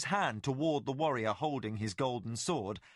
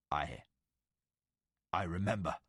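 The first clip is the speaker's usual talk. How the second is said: ancient Valheru lord; 8 semitones lower than usual. Acted. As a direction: speak as a legend come to life. Tomas recalls past.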